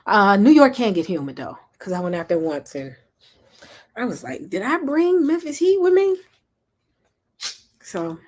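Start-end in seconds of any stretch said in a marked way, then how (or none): 4.5-6.2 mock surprise. Mock quote of herself in New York.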